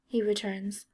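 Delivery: ordinary delivery, nothing marked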